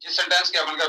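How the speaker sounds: usual speaking voice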